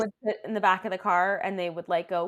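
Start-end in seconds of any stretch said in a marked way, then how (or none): none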